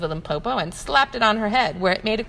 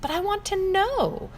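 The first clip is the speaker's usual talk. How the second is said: put-on high, pleading little child voice; insistent child; 11 semitones higher than usual. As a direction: insist like a small stubborn calf.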